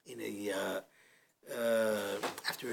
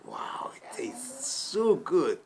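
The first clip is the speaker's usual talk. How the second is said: savoring whisper; 4 semitones higher, 3 dB louder, much slower than usual. Acted. Waiter relishing the camel milk.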